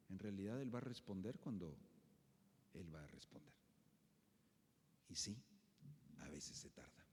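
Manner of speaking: soft, low, reassuring voice. Acted. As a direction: reassure them gently that God answers. Reassuring sermon close.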